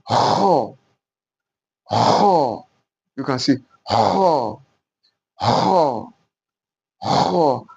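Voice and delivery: "ho" scraped from the back of the throat; throaty ho. Shown as a demonstration. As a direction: say "ho" as if clearing mucus from the throat. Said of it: "release mucus from your throat".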